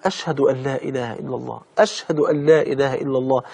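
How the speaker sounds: very soft, low murmured creed; hushed testimony. Acted. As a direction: breathe the creed quietly through grief. Mother whispering testimony. (همس خافت بالشهادة)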